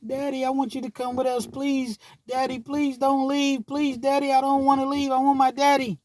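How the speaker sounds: child crying for daddy to stay, voiced by her father; pleading little daughter. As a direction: beg your daddy not to leave, like a tearful three-year-old. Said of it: "and she's".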